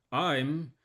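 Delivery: calm pilot reporting his own collapse; deadpan captain dying over intercom; pilot cockpit headset. Acted.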